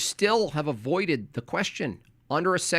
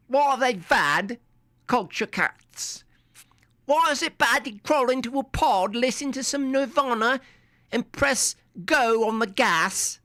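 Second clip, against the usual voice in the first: put-on high, taunting British accent; high mock British accent aimed at Culture Cats; 10 semitones higher than usual. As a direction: needle them in a silly British accent. Mocking British voice at caller.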